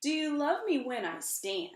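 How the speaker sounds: slow, swelling spoken-word performance of a poem; dramatic poem reading. Acted. Author reads her book to the audience, impassioned poem reading. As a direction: perform the poem with rising passion.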